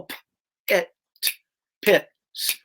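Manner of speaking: sounding it out sound by sound, broken up; slow sounding out. Shown as a demonstration. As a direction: stumble through the word one sound at a time like a struggling reader. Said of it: "So kids will go".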